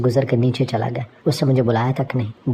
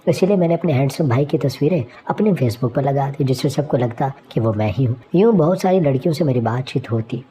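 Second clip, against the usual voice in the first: usual speaking voice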